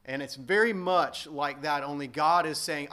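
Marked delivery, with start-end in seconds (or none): none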